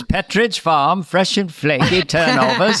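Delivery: put-on old-time New England ad accent; slow New England pitchman accent. Shown as a demonstration. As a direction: drop the r's like a New Englander. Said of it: "That's the way you do it".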